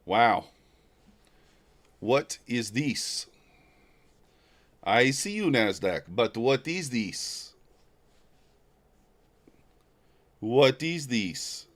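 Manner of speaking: slow, lightly put-on African accent; puzzled, drawn-out words in a playful African accent. Streamer puzzling over the Nasdaq chart, mock African accent. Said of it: "Is that my African accent?".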